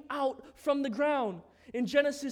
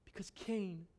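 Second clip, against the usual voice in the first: soft scripture reading; 6 semitones lower, 10 dB quieter than usual. Acted. Preacher closing the Cain and Abel story by reading scripture: very soft. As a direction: read it gently, almost under breath.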